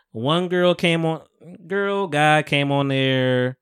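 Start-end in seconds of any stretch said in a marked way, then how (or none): none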